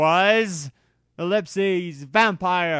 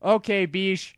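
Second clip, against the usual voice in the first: sad; 6 semitones higher than usual. Acted. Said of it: "I said sadly".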